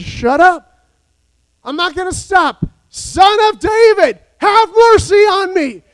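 high, loud desperate cry; begging shout for mercy. Acted. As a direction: cry out over the crowd. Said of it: "He shouted and shouted".

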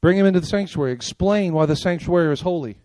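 normal talking voice

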